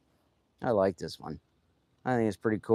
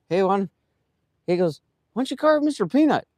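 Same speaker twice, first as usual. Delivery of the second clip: high-pitched, louder mock coworker pitch; eager retold suggestion; 10 semitones higher, 4 dB louder, faster than usual. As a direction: imitate a coworker throwing out an idea.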